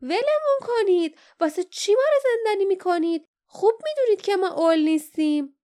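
loud, high-pitched outraged yell; shouting in protest. A narrator's reading. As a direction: cry out against being locked up. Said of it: "جاسمین فریاد زد".

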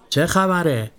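high nasal policeman's question. A narrator's reading. Policeman pushing through the crowd, nasal and high. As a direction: ask it through the nose like a bossy cop. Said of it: "با صدای تو دماغیش پرسید".

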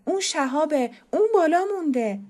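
high, loud alarmed cry; shouting from below. Acted. Someone downstairs shouts that the boy is trapped upstairs, high and loud. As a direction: yell it up the stairs in alarm. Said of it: "کسی از پایین داد زد".

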